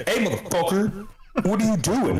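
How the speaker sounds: high pitched snarling dog voice; angry dog voice. Retold or imitated. Dog barking at neighbors walking to their cars, high angry yell. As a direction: play the guard dog yelling at the neighbors.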